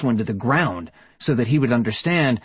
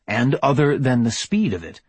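plain reading voice

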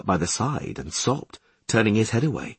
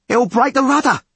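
very high, loud bellow; alarmed bellow; 7 dB louder, faster than usual. A narrator's reading. Skipper warning crew about the rudder, alarmed bellow. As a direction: bellow a warning across the deck. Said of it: "Podlorak bellowed".

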